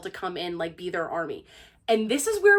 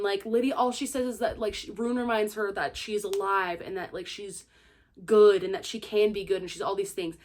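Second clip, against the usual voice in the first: ordinary delivery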